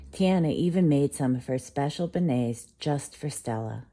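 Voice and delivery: usual speaking voice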